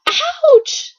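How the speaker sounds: very high, loud yelp of pain; screamed. A narrator's reading. Steffi pounced on by the boy, high scream. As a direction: shriek it out as if suddenly hurt. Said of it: "Steffi screamed".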